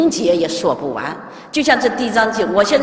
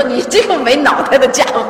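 loud, higher-pitched imitation telling-off; hammed-up angry master's rebuke; mock scolding; 4 semitones higher, 5 dB louder than usual. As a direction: scold a disciple, acting it out. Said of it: "我就得训他".

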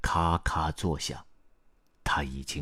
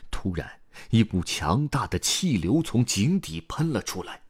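ordinary delivery

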